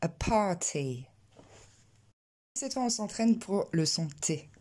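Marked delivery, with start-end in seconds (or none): 0.0-1.1 slow "a party" with puffed p and t; deux plosives aspirées. Shown as a demonstration. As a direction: model English "a party", both plosives exploded. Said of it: "je vous invite à répéter derrière moi". (« a party » lent, p et t soufflés)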